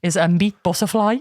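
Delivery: normal talking voice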